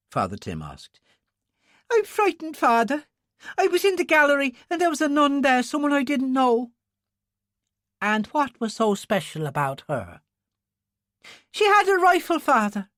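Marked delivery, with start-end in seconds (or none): 1.9-6.7 put-on very high, tearful child's voice; frightened young boy. Altar boy tells the priest what he saw, frightened. 8.0-10.2 high, soothing priest's voice; priest questioning a child. Acted. Father Tim questions the frightened boy, gently probing. 11.5-12.9 put-on very high, urgent child's voice; frightened young boy. Altar boy reveals the rifle to the priest, frightened.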